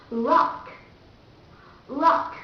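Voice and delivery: rock with the English R sound. Shown as a demonstration. Teacher comparing two languages, English R in rock. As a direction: say rock with an English R, not a Vietnamese one. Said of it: "this is English and this is Vietnamese".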